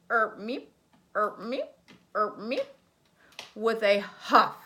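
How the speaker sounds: usual speaking voice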